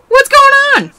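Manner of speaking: sharply high, loud disbelief; baffled cry. Acted. Student yelling in disbelief at robot Nekomaru. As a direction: yelp out total confusion.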